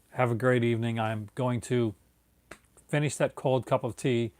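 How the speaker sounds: ordinary talk, nothing marked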